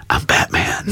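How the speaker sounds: hushed, husky Batman whisper. Retold or imitated. Little boy reassuring his mom, hushed mock Batman voice. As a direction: drop to a near-whisper, like a superhero's secret. Boy's defiant Batman line in story.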